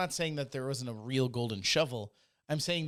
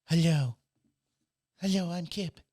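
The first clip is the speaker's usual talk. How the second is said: louder, higher cartoon voice for a dog; higher put-on cartoon introduction; Kip from Futurama; 4 semitones higher, 6 dB louder than usual. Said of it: "I just totally made him sound like Kip from Futurama".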